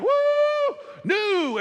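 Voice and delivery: squealed high, gleeful and giddy; a newcomer thrilled by church; mock excited woo. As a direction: gush like it's all brand new.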